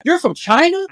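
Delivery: high, thrilled question; eager curiosity. Retold or imitated. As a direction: ask like meeting a celebrity. Excited question to student.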